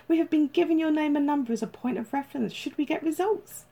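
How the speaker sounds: high, bubbly telephone saleswoman manner; chirpy agency caller. A narrator's reading. Agency caller rings detective with news, chirpy. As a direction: lift the pitch, bursting with good news.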